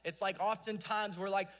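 ordinary talk, nothing marked

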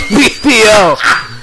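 high, slow, loud echo; stretched high-pitched imitation; mock holler. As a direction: call it out drawn-out, amused. Repeats the inmate's holler, laughing.

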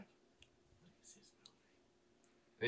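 a slow puzzled whisper; whispered aside. Acted. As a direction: whisper it slowly to yourself. Quartermaster whispers to himself.

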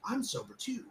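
lightly mocking high-voiced imitation; retold proud announcement. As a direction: pitch it up like a clueless boast. Retold drinker announces his sobriety.

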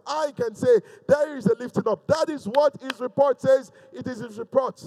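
high, loud rallying declaration; full-force preaching. Real, not acted.

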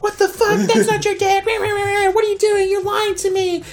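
put-on high, shocked girl's voice; a stranger calling out the lie; outraged girl. The girl on camera catching him out, high and shocked. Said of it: "and she's like".

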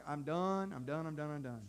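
low, soft, fading repetition; quietly giving up. Acted. Someone whose luck was pressed too far, quietly finished. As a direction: let it sink away as you give up.

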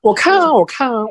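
normal talking voice

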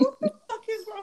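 high, laughing disbelief; laughing exasperation. Retold or imitated. Scene: exasperated at her friend and son grabbing dogs, laughing high.

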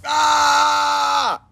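high mock scream; imitated scream. Kid screaming outdoors, high mock wail. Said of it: "Screaming as loud as you can".

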